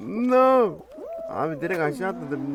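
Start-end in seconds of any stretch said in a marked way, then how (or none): 0.1-0.8 loud, high no; exasperated shout. Real, not acted. Gamer shouting as his run fails, loud and high. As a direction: cry out as you fail. Failing in the game.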